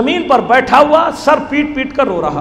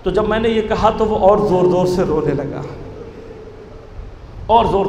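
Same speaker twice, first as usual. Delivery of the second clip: usual speaking voice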